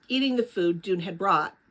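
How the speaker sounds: usual speaking voice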